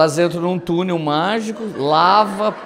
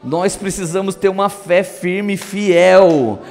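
loud, impassioned sermon climax; fervent preaching; 10 dB louder than usual. Real, not acted. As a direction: build from firm, slower exhortation to a full-force shout. Preaches, building to a shout.

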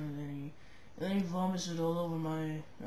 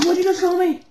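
high furious yell; 12 semitones higher, 17 dB louder, faster than usual. Acted. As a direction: scream the question louder. Offended repeat, louder.

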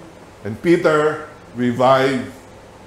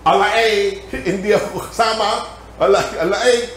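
higher-pitched Batangas Tagalog denial; Batangueño accent; 5 semitones higher than usual. Acted. Peter denying he was with them, in Batangueño accent. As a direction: deny it in a thick Batangueño accent. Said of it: "that's the accent of a Batangueño".

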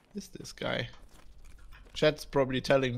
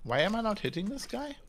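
ordinary delivery

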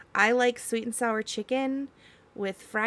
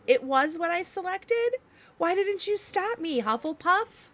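mock outrage; 6 semitones higher, 4 dB louder than usual. Real, not acted. Realizes wrong selection.

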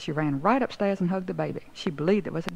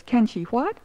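higher, incredulous question; calling from upstairs; 5 semitones higher, 4 dB louder, slower than usual. A narrator's reading. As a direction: call back down, not believing the question. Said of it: "says Stella Rondo from upstairs".

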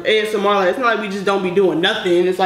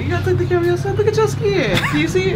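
excited high voice; 10 semitones higher than usual. Real, not acted. Showing off skin to camera.

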